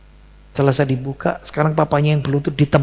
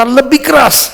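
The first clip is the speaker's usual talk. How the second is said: loud and higher command; louder command; 11 semitones higher, 7 dB louder, slower than usual. Retold or imitated. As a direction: push the order up hard. Ayah menyuruh lagi lebih keras. (ayah berseru lebih keras lagi)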